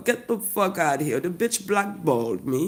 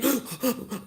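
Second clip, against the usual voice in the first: mock huffing gasp; 4 semitones higher, 4 dB quieter than usual. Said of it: "huffing and puffing".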